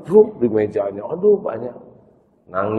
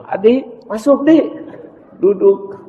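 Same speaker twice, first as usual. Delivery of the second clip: higher lady's voice; high mother voice; 12 semitones higher, 5 dB louder than usual. Acted. Mother invites Adi into the car, high. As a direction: invite the boy in.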